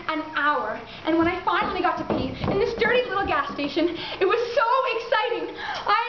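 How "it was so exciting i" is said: high, thrilled outburst building into a cry; exultant astonishment. Acted. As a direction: relive it in breathless, high-pitched amazement. Acts out thrilled moment of first moan.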